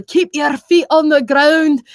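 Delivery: stretched-out game voice line; World of Warcraft character. Acted. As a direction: call it out slowly like a video game character. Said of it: "I did a World of Warcraft impression".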